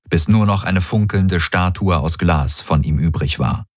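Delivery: plain reading voice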